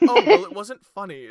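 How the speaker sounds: loud, high, indignant snap; mock-offended retort; snippy reply. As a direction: fire it back, offended. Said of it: "she's like".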